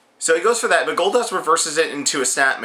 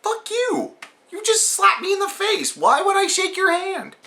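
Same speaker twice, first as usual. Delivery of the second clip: mock outraged yell, much higher; pitched-up indignant rant at the opponent; angry yell. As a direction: shriek it in fake outrage at the handshake. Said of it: "I was like".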